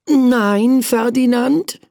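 high, firm self-warning; prince scolding himself. Acted. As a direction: shake himself awake. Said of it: "sagte er zu sich".

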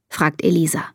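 plain reading voice

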